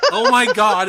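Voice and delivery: ordinary delivery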